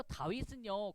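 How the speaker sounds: plain reading voice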